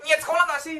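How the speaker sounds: mock the child's effort, voice up high; sneering scold. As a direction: parent sneering at the child's schooling. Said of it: "Nggak boleh gitu dong".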